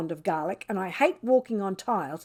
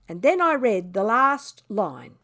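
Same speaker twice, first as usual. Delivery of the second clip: ordinary delivery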